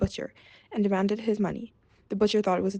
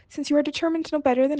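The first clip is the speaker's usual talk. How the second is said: angry; 7 semitones higher than usual. A narrator's reading. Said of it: "quite angry".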